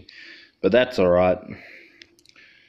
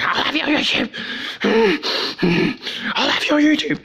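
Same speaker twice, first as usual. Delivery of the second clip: much higher mocking voice for the threat; mock troll imitation, much higher; mocking squeaky troll. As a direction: squeak it out like a whiny kid at his computer. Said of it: "that's what I'm assuming he was like at his computer".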